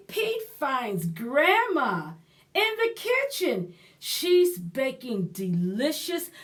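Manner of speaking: ordinary delivery, nothing marked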